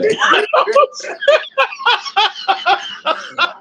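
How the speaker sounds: big burst of laughter; loud laugh. Real, not acted. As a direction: cracks up hard, high and loud. Reacts to the joke.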